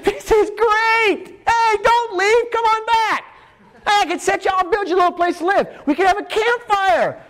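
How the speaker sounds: high-pitched, eager gushing; excited babbling Peter. Acted. Peter begs the others to stay, gushing excitedly. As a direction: gush in a high, overexcited voice.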